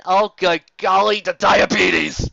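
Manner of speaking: loud, slow, hammed-up wail at the sweetness; mock overload cry. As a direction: drag out the diabetes like it's killing you. Sarcastic climax of cuteness overload.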